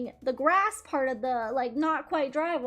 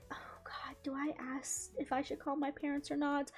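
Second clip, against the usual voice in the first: retold inner worry, whispered and much softer; wondering whether to call her parents after the crash, whispered; worried whisper; 10 dB quieter than usual. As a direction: breathe it out like a secret you're unsure of. Retelling her own worried thought.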